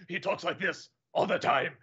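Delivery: low gruff put-on voice; gruff dubbed voice. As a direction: mimic a badly dubbed, gruff movie star. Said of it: "Just gruff. Just the gruff".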